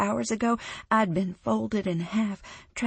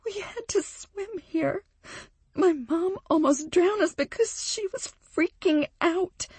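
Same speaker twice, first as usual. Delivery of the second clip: tearful; 11 semitones higher than usual. Acted. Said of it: "Her voice breaks and she starts to sob".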